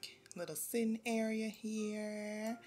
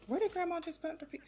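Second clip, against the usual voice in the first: baffled; 11 semitones higher, 4 dB louder, faster than usual. Real, not acted. Searching for lost pizza.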